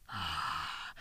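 a long, deep sigh on ah; 深いため息; heavy sigh. A narrator's reading. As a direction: sigh it out in dismay. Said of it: "と大きく短足して".

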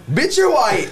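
high, mocking comeback; mock angry retort. Buddy firing back at the woman, high mock retort. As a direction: shoot it back high and sharp. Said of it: "he goes".

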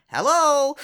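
high, loud singled-out hello; mock phone greeting. Grandparent answering the phone, mock high greeting.

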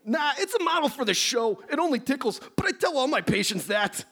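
high, nasal-free nerdy professor; nerdy scientist voice. Acted. Plays Beekman.

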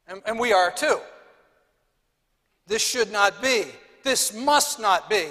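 loud, high outraged declaration; indignant preaching. Acted. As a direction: proclaim it like a verdict.

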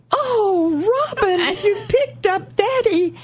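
high, crying voice breaking over the words; a sudden high tearful wail. Retold or imitated. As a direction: choke it out through happy tears. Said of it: "of course, I started crying".

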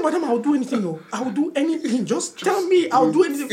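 high, urgent mock plea; hammed-up panicked bargaining; desperate begging. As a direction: plead frantically to escape the needle. Desperate begging to avoid the injection.

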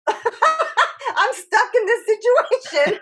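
high, loud, laughing through the words; laughing despair. Retold or imitated. Realizing her parents know nothing, she laughs helplessly.

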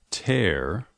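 usual speaking voice

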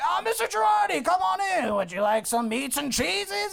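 high, hollering welcome; effusive boss. Acted. Boss inviting Girardi in, hollering heartily. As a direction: greet the guest with hammed-up bluster.

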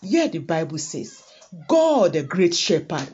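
loud, driving sermon voice; preaching at full force. Acted. Preacher urging the congregation to trust God, loud and fervent. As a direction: press it home to the congregation.

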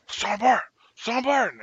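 high-pitched urgent calling out of a name; retold yell. Fellow soldier urgently calling the speaker back to the truck, high shout. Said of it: "he's screaming at me".

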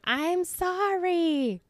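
high, stretched-out whine of an apology; imitated sheepish sorry, sung out long and high; drawn-out mock apology. Mimicking Jess's apology at family teasing.

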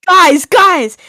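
loud, high hammed-up appeal; frantic call. Reading own message to the group chat, frantic. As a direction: call out in mock panic.

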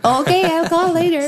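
a mother's high chirpy reply. Retold or imitated. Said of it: "Like my mom".

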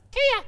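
high piercing bird cry; kea call. Shown as a demonstration. Host imitates the kea bird beside the car, high screech. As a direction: screech it like the alpine parrot. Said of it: "that's the sound that they make".

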